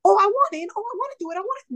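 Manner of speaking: imitating excited friends begging to join; high, gushing mock eagerness; eager friends.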